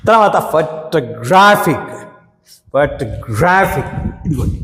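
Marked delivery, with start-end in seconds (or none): 0.5-3.9 photographic slow, weight on gram; stress on third syllable. Shown as a demonstration. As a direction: drill photographic leaning on gram. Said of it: "ఇక్కడ stress వచ్చింది". (గ్రా మీద ఒత్తిడితో నెమ్మదిగా photographic)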